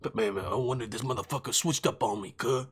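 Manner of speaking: low, soft, lightly voiced paranoid gangster. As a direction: mutter it like a suspicious dealer. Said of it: "I was just doing, like, a real, like, gangster".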